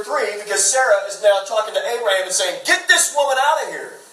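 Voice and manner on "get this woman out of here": higher-pitched acted outrage; indignant demand. Sarah talking to Abraham at the party, acted indignant demand. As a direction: act out a fed-up wife's order.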